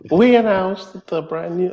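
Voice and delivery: high, loud, drawn-out put-on voice; Jenny impression. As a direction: put on a slow, high-pitched impression of a colleague. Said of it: "try to do my, my best, uh, Jenny impression".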